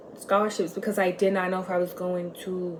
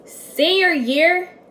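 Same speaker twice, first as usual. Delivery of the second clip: Senior year much higher and slower, rising; stressed; 10 semitones higher, 8 dB louder, much slower than usual. Real, not acted. Telling viewers when she started abusing it, senior year drawn out high. Turning point in her story.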